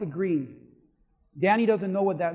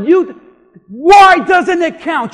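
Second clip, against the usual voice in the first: slow, louder, higher indignant question; exasperated demand; 10 semitones higher, 15 dB louder, slower than usual. Retold or imitated. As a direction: stretch out the disbelief. Pastor demands reason, exasperated.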